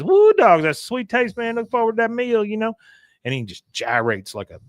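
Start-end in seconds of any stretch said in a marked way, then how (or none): 0.0-2.7 leer at her in a high voice; Patch impression. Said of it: "Patch runs up to her and says".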